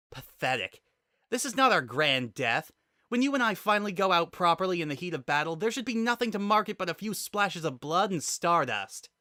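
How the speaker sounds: a pinned fighter railing at her captor; rising to a heated, defiant peak; fierce warrior heroine. Acted.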